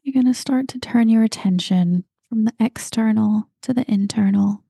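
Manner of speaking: normal talking voice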